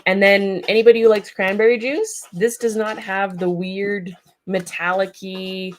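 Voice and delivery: ordinary talk, nothing marked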